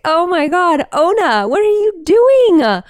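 high, loud, stretched-out horror; horrified shout. Retold or imitated. Owner finding her dog covered in whale guts, loud horrified cry. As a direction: shriek at the filthy dog. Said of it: "And I'd be like".

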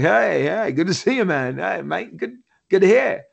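lightly voiced friendly movie star; Chris Hemsworth, lightly imitated. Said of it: "And Hemsworth was like".

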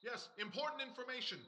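distant, soft, from up on a roof; calling from far above. Acted. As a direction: call down from the rooftop, far away.

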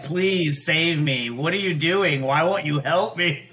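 ordinary talk, nothing marked

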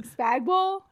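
SPAGBALL said questioningly, second half like "ball"; questioning mispronunciation. Shown as a demonstration. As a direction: try out "Spagball" with a doubtful lift. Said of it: "I pronounced it wrong".

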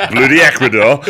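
laughing, loud posh put-on; posh Berkshire voice. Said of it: "It's his Berkshire voice".

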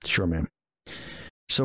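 ordinary talk, nothing marked

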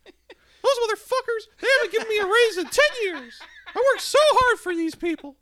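high, loud squeaky cartoon voice, laughing; Mickey Mouse coworker. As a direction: rant like an angry squeaky mouse. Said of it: "He goes".

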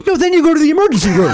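high, quick retold rebuke; mock emphatic correction. Host acts out the medic correcting him, mock insistence.

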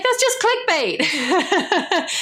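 scoffing with a laugh. Real, not acted. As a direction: laugh it off dismissively.

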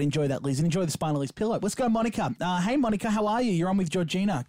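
ordinary delivery

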